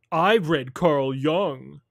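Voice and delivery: very slow, smug voice; smug mock quote. Mocking the filmmakers' pretension, very slow and smug. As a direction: stretch it out like a self-satisfied know-it-all.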